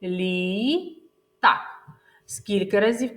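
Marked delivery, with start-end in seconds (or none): 0.0-1.6 Лі-так said as two split syllables, Лі then так. Shown as a demonstration. Teacher splits Лі-так into syllables for children. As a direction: clap out Лі-так in two beats. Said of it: "Промовляємо".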